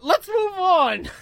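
slow, high, loud let's move on; drawn-out move along. Real, not acted. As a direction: drag it out like you are sick of it. Groaning after the lame joke.

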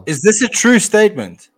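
incredulous repeat. Real, not acted. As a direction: demand it again in disbelief.

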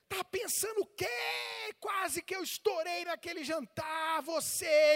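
high shouted scolding; acted angry rant. Replays angry rant at wife.

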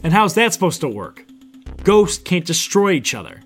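loud, high challenge; shouted. A narrator's reading. Narrator challenging the villain's plan from the floor, shouting. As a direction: shout it across in protest. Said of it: "I shouted out".

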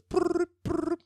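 high trilled "brr" ringing; mouth-made phone ring. Retold or imitated. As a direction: imitate a ringing phone with your lips. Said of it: "It's the guy going just like me".

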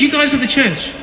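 playful preacher refrain; preaching to his kids. Retold or imitated. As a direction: repeat it like a pulpit refrain. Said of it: "Preaching to him".

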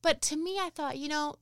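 high, strained voice fighting back tears; tearful pitch lift, words catching; choked up. Real, not acted. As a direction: keep talking through real emotion. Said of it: "I'm gonna get choked up".

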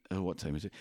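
ordinary talk, nothing marked

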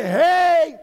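high, loud rallying call; Jesus. Acted. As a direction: call out triumphantly to gather everyone, drawing the words out. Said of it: "he's going to say".

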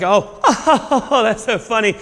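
hammed-up tickled reaction; mock laughing. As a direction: fake a laugh through the words. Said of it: "like, laugh like".

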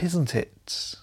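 breathy conspiratorial whisper. Retold or imitated. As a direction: whisper it aside, just for your friend. Camp aside to friend.

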